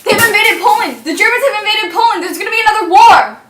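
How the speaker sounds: loud, fast panicked news shout; alarmed shouting. Acted.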